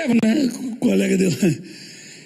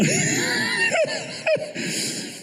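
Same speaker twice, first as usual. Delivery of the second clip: big laugh; 6 dB quieter than usual. Real, not acted. Laughing at punchline.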